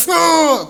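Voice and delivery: loud, high growl of frustration; enraged groan. Acted. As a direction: let out an exasperated "ah". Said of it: "con la rabia".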